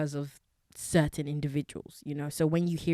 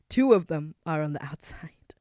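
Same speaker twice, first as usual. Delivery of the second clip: laughing whisper; 6 dB louder than usual. Real, not acted. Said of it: "Let me do that again".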